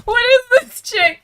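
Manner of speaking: mock the stunned class; a light imitation of baffled classmates. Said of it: "Everyone was like".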